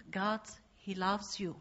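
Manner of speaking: usual speaking voice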